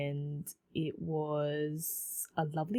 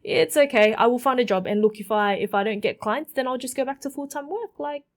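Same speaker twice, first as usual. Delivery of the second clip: fast, loud, higher pleading reassurance; reassuring her mum; 6 semitones higher, 6 dB louder, faster than usual. Retold or imitated. Past self reassuring worried mum, fast and loud. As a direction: rush to calm mum down. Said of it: "I'm like".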